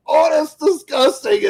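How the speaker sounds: a scam caller recoiling in comic disgust. Retold or imitated. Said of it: "One of them is just like".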